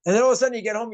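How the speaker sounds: ordinary talk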